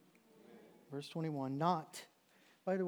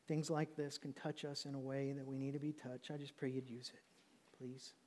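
hushed closing prayer, trailing softer; 2 semitones lower, 5 dB quieter than usual. Real, not acted. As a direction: pray quietly and humbly.

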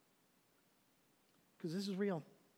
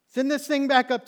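loud, high urgent yell; high-pitched, forceful command; desperate shouted demand; 9 semitones higher, 16 dB louder than usual. Acted. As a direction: shout it like you need out now. Acted rider demanding elevator go up.

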